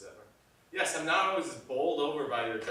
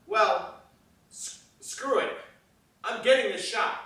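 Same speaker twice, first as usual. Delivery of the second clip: loud, higher-pitched, emphatic defiance; stubbornly overruling an objection; defiant; 6 semitones higher, 8 dB louder than usual. Acted. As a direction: put your foot down loudly.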